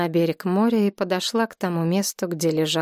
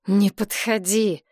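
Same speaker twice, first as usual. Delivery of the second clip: plain reading voice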